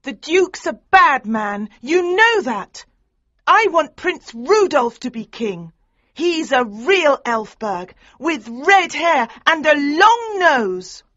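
high, loud, slow indignant voice; angry daughter. A narrator's reading. Daughter angrily contradicts her mother about the Duke. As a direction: argue hotly for the prince. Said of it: "She was angry".

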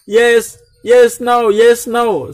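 high, loud playful chanting; mock yes-no. Vlogger mocking his own yes-or-no English. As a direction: tease your own poor English.

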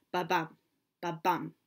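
ba-BUM, weak then strong beat; rhythmic ba-BUM, second syllable hit harder; iambic beat demo. Shown as a demonstration. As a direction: tap out the rise, light ba then punched bum. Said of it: "That second syllable is stronger".

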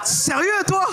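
high shocked shout; half-laughing disbelief, yelled out. Retold or imitated. Brother's shocked retort. (le frère, choqué, crie très aigu)

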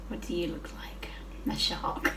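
unvoiced whisper of "What do you look like?", no pitch at all. Shown as a demonstration. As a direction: breathe the question to the shark in a hushed whisper. Repeats question to pet shark.

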